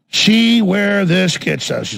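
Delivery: very slow, drawn-out warning; slow ominous repeat. Real, not acted. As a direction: stretch each word out deliberately. Repeats the words slowly for effect.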